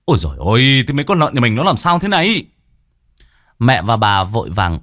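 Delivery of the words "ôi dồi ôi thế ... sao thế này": higher, fast alarmed father; panicked cry. A narrator's reading. Father yells in alarm from the pigsty. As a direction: cry out at the sight. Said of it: "hốt hoảng la lên".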